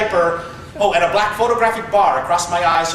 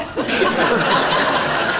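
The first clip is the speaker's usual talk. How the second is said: breathy laugh; 4 semitones higher than usual. Real, not acted. Laughing as he retells mom's blunt answer to the reporter. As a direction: laugh under your breath at the punchline.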